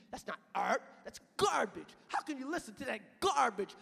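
usual speaking voice

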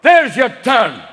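loud, high, cursing Scottish sat-nav; Scottish GPS. Retold or imitated. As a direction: bark the directions in a furious Scottish accent. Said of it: "I want a Scottish G P S".

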